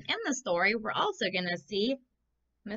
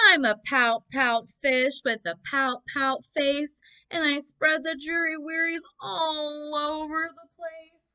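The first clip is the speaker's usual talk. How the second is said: mopey, louder sing-song fish lament; gloomy fish voice; 6 semitones higher, 5 dB louder than usual. Acted. Teacher voicing the sulky fish's refrain for the class. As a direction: moan it like a glum fish.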